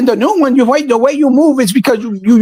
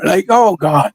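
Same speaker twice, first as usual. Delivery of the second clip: usual speaking voice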